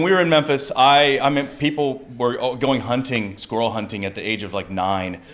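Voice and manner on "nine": usual speaking voice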